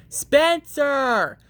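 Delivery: loud, high call of his name; mother yelling her son's name; yelling up the stairs. Acted. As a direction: shout for Spencer from below. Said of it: "she yelled".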